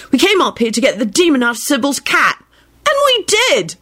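rising to a high proud finish; triumphant. A narrator's reading. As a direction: crow about the win. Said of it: "he added triumphantly".

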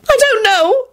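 high-pitched miserable wail. A narrator's reading. Ashamed woman answering about her debts, miserable. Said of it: "she said miserably".

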